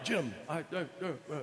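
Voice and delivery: Kirk stammer. Retold or imitated. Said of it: "he's always doing that".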